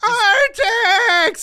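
high, wailing shout of a name; hammed-up movie impression. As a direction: scream for a lost horse, overdone. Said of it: "this is my impression of the entire movie".

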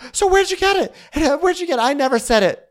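high, loud denial; hammed-up squeal of innocence; mock shrill denial. Rinna denying to Garcelle, high mock squeal. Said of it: "recap of Rinna's reply".

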